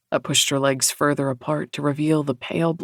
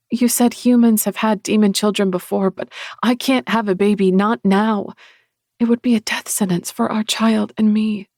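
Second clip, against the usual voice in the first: higher, louder, urgent protest; anxious pleading; 5 semitones higher, 5 dB louder than usual. Acted. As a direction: plead with rising alarm.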